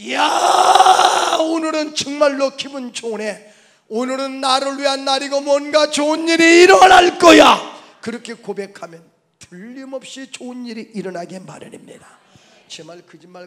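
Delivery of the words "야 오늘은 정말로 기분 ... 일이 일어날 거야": high, very loud jubilant shout; exultant proclamation. Acted. Preacher models a morning confession for the congregation, exultant shout. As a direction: cry it out with rising, long-held elation.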